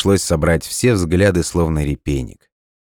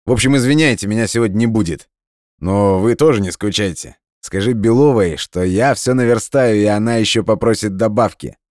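higher-pitched, carefree friend on the phone; hasty, cheeky add-on; 6 semitones higher than usual. A narrator's reading. Friend adding a message for the assistant, hasty and cheeky. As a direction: toss it off jauntily, pitched up. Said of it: "он поспешно добавил".

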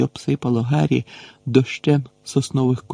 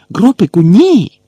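loud, much higher cry; alarmed shout; 7 semitones higher, 9 dB louder than usual. A narrator's reading. Gamekeeper yelling at the giant grabbing the girl, loud alarmed. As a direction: yell to stop him now.

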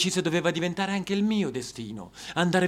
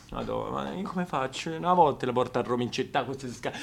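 despairing aside; 6 semitones lower, 9 dB quieter than usual. Acted.

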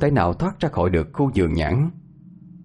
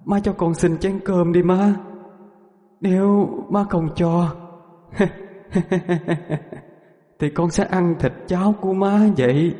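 higher, slow mournful ghost's plea; wailing ghost; 8 semitones higher than usual.

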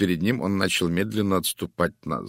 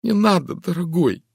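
higher, breaking plea; broken pleading; 11 semitones higher, 6 dB louder than usual. A narrator's reading. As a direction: beg him, voice cracking. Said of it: "надломленным голосом взмолилась".

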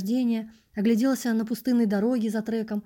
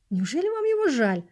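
indignant; 6 semitones higher than usual. Acted. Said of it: "возмутился прохожий".

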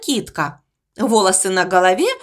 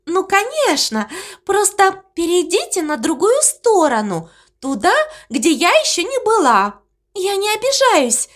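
squeaky voice; 7 semitones higher than usual. Acted. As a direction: squeak it out dreamily. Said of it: "Овечка засмеялась". (писклявый голосок)

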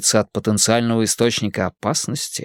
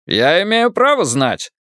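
put-on higher, insistent Irish accent; 7 semitones higher than usual. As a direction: demand it in an Irish brogue. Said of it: "Ирландский акцент работал на всю катушку".

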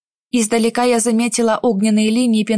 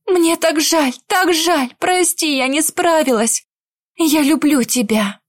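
desperate apology; 5 semitones higher than usual. Acted. Heroine's tearful farewell to captive.

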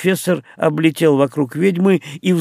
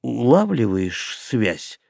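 slow, lower, stunned question; dumbfounded; 5 semitones lower, 3 dB quieter, much slower than usual. Acted. Henry, utterly baffled, turns to the witch with a slow, stunned question. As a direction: drag out each word in disbelief. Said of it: "совершенно ошарашенный Генри".

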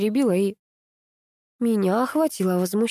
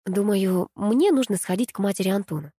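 usual speaking voice

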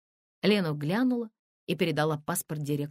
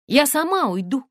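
высоко, громко, решительно; заяви с вызовом, вскинув голос; возмущённый протест; 10 semitones higher, 9 dB louder than usual. A narrator's reading. Возмущённая Тонечка спорит с директором. (возмущённо и решительно)

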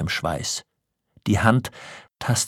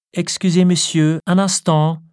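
high, louder, ladylike excuse; apologetic; 10 semitones higher, 6 dB louder than usual. A narrator's reading. Wife excuses herself to the gentlemen, high and apologetic. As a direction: call back a polite apology, pitched well up. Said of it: "wandte sie sich zuvor noch entschuldigend".

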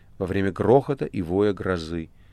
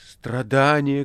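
slow, much higher and louder cry; ecstatic shout; 5 semitones higher, 4 dB louder, much slower than usual. A narrator's reading. Margarita shouting at the burning room, loud and slow. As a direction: hurl it at the flames in ecstasy. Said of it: "кричала Маргарита".

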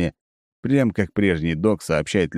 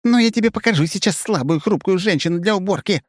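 furious bark; 12 semitones higher than usual. A narrator's reading. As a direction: throw the words back in rage. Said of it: "рявкает кадет".

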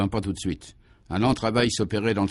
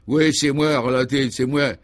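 louder, raised-pitch admission; last-ditch defiance; 4 semitones higher, 5 dB louder than usual. A narrator's reading. Cornered gangster admits it to the commissioner, in a last defiant burst. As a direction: concede it forcefully, still struggling. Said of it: "il eut un dernier sursaut".

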